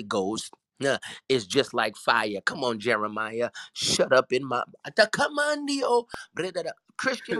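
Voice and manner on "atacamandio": tongues. Real, not acted. Burst of tongues.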